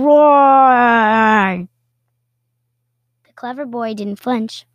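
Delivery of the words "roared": roaring read. A narrator's reading. Narrating the dragon's roar.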